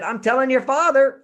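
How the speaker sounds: a loud mother's warning; mother's threat. Retold or imitated. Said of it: "my mother said".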